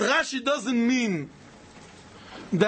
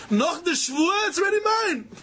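high-pitched protest, mildly shouted; mock yell, pitched well up; acted yell; 7 semitones higher than usual. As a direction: call it out like a claimant staking ownership. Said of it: "I yelled already".

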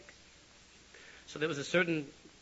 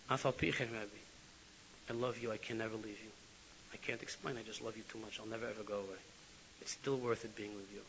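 tearful; 3 semitones lower, 7 dB quieter than usual. Retold or imitated. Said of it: "he was crying uncontrollably".

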